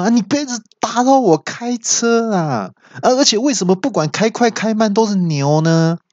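high, fed-up comic yell; frustrated yell. Retold or imitated. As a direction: complain at the GPS in a high wail. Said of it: "那我听到我就大叫说".

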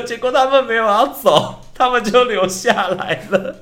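high, loud laughing wail; laughing exclamation. Real, not acted. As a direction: cry it out through helpless laughter. Laughs at spirits staying.

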